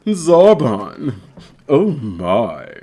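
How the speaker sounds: drawn-out, put-on anime voice; character voice. Streamer performing for viewers, drawn-out anime character voice.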